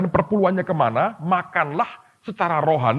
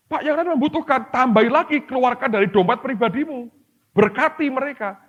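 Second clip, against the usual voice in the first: high, pushy plea to give more; pitched-up mock exhortation; urging appeal; 10 semitones higher, 4 dB louder than usual. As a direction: press the believer to add from his own wallet.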